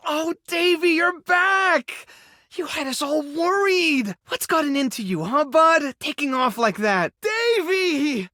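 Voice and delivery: very high, gushing welcome to a pet; overjoyed relief. Acted. Welcoming runaway Davy back after worrying, overjoyed. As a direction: greet a lost dog in a soaring, delighted voice. Overjoyed reunion with lost dog.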